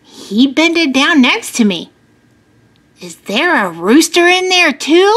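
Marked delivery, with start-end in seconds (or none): 3.0-5.1 put-on higher, uneasy child's question; a touch of worry; lightly scared little kid; kind of scared. A narrator's reading. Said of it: "I asked, kind of scared".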